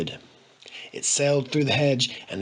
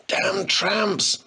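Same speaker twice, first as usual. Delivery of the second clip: croaked; 8 semitones higher than usual. A narrator's reading. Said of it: "he croaked".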